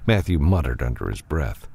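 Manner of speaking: usual speaking voice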